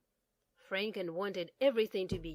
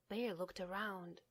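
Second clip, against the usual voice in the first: ordinary delivery, nothing marked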